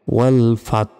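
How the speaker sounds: ওয়াল ফাত cut at the t; stop before ha. Shown as a demonstration. Teacher breaking down the word, ওয়াল ফাত stopped at t. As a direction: halt ওয়াল ফাত right on the t. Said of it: "ফাত বলার পর".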